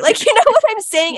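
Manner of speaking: laughing high and loud through the question. Real, not acted. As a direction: burst out laughing as you ask. Laughing about the sloppy other girl.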